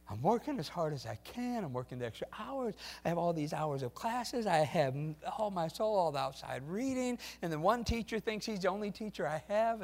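lightly voiced weary student; struggling student. Preacher voicing a tired college student, building despair. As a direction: complain like a worn-out student, rising.

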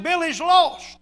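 a retold, loud anguished cry. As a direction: cry it out like a desperate brother on the phone. Brother's crying phone call retold.

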